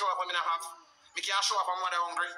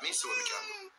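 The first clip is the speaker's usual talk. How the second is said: mother crying. Retold or imitated. Mother answers, tearful falsetto.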